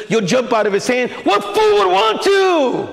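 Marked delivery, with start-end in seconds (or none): 1.2-2.9 much higher, slow incredulous cry; who could possibly want that?. Real, not acted. Preacher to congregation, incredulous and drawn out.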